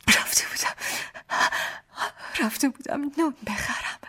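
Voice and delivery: speaking through tears, broken start; tearful. Retold or imitated. Wife answering her husband through tears. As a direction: choke the words out while crying. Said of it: "با گریه گفتم".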